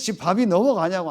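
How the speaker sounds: father scolding his daughter, indignant. Retold or imitated. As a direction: rebuke her for eating calmly.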